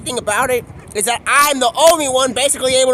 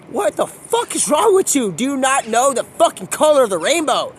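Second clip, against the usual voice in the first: exasperated yell; 5 semitones higher, 5 dB louder than usual. Retold or imitated. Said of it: "I'm like".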